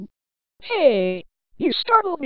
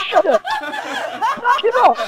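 loud, fed-up shout; annoyed shout; 5 semitones higher, 6 dB louder than usual. Acted. Teased friend shouting at the laughing gang to stop. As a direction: yell to make them stop.

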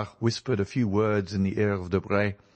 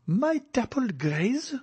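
high, slow, startled question; as if she can't believe her eyes; startled demand; slower than usual. A narrator's reading. The Baroness seeing her own horses at the window, demanding in shock. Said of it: "demanded the Baroness".

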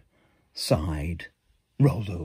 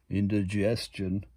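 ordinary delivery, nothing marked

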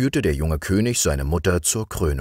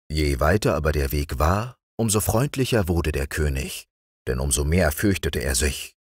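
plain reading voice

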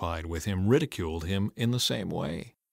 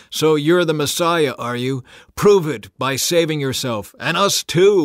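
loud, high sneering taunt; scoffing; 6 semitones higher, 8 dB louder than usual. A narrator's reading. Criminal beside Jesus scoffing, loud high taunt. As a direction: sneer and dare him.

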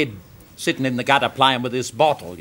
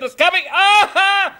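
very high, frantic acted cry; excited yell; 5 dB louder, slower than usual. Doctor as the baby arrives, high excited yell. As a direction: call out as it all happens.

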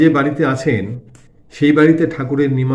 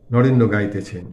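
ordinary delivery

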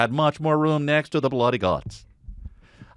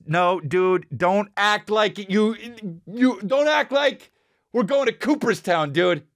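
mock exasperated scold; 7 semitones higher than usual.

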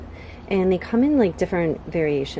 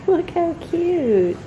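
high, slow, gushing coo; 11 semitones higher, 4 dB louder, much slower than usual. Real, not acted. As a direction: coo over the plant. Cooing over planted pot.

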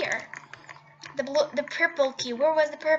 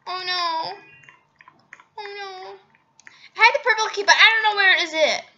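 ordinary delivery

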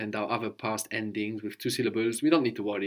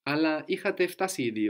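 ordinary delivery

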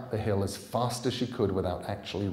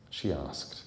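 ordinary delivery

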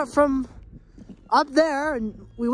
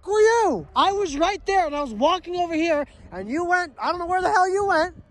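angry; 4 semitones higher than usual. Real, not acted. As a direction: chew him out for vanishing. Angry quarrel after friend vanished.

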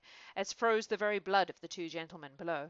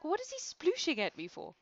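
high puzzled mock question; mock confusion; 10 semitones higher than usual. Reader mocking her confusion at the noise, high and puzzled. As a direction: squeak it out, baffled. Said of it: "I was like".

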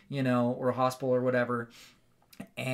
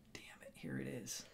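breathy whispered dread, much softer; 7 semitones lower, 20 dB quieter than usual. Retold or imitated. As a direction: whisper it as the horror arrives. Dread as nuts appear.

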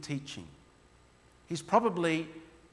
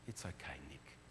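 retold quiet reassurance, much softer; 13 semitones lower, 18 dB quieter than usual. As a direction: say it barely above a breath, gently. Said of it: "very quietly says".

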